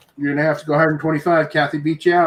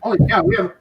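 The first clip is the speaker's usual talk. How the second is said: surprised exclamation; faster than usual. Real, not acted. Surprised at a hundred fourteen viewers.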